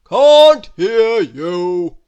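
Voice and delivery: slow, very loud bellow; greengrocer's booming shout. A narrator's reading. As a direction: bellow it, stretching each word. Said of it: "bellowed the greengrocer".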